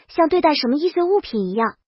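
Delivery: usual speaking voice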